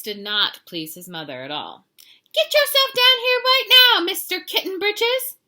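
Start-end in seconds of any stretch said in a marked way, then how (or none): 2.3-5.4 very high, loud scolding mama; shrieked parental command; bossy mother's order. A narrator's reading. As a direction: yell it up high like a mother fed up with her kitten. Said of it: "ordered Mama June Bug Jones".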